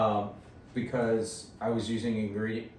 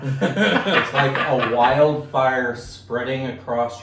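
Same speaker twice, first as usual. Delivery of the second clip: slow, louder, laughing; laughing through the burn; 2 semitones higher, 8 dB louder, much slower than usual. Real, not acted. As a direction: laugh it out slowly. Burning mouth, laughing.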